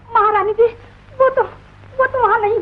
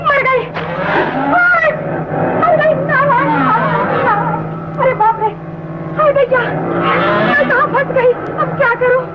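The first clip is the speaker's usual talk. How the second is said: panicked screaming; 4 semitones higher than usual. Acted. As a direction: scream for help among the snakes. Maid trapped among snakes, screaming. (डर से चीखती पुकार)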